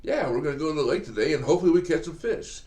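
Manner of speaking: put-on upbeat dad pumping up the kids; excited. Shown as a demonstration. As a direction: sell the trip with bright eager energy. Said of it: "if you say it like this".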